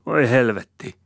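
high, loud curse; exasperated cry; exclaiming. A narrator's reading. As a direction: burst out in exasperation. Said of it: "huudahtaa Rosina".

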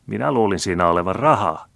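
saucy. A narrator's reading. Maid hints to the old man about money, saucy. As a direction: say it with cheeky innocence, a little high. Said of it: "kuului Piika sanovan samalla äänellä".

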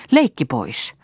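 mildly stern lecture to a sister. A narrator's reading. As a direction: put a stop to the joking, firmly. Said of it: "sanoi Lea ankarasti".

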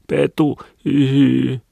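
put-on high wailing little boy voice; crying child. A narrator's reading. Small boy blaming his brother to mother, wailing in tears. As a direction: whine it tearfully, sob drawn out high. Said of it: "kovemmin itkemään".

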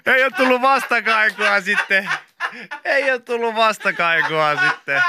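high, helpless laughing words. Real, not acted. Teasing co-host about unanswered post, laughing hard. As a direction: squeeze it out through real laughter.

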